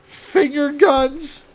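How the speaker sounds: high, loud, drawn-out disbelief; incredulous. Real, not acted. Game master reacting to finger guns, loudly incredulous. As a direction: cry it out slowly as a question. Reacting to player's silly move.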